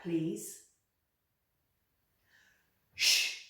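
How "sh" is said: Sh held as a soft hiss; a teacher's shushing Sh; sh sound. Shown as a demonstration. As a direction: model a long Sh for kids. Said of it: "Can you say that".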